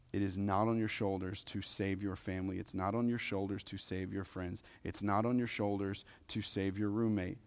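normal talking voice

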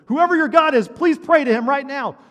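a pilot begging the cabin to pray; panicked pilot plea. Retold or imitated. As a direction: pilot panicking over the intercom, shouting. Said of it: "the pilot got on and said".

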